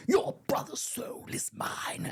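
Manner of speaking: high, slow, hammed-up whispered villain threat; villain movie quote. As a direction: hiss it out like a film villain claiming a soul. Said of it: "Mortal Kombat, a terrible film".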